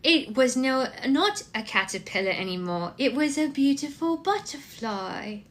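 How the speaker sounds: put-on high, tiny toddler with partial British accent; baby British accent. Toddler believing she reads a picture book, baby British accent. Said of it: "like, a partial British accent".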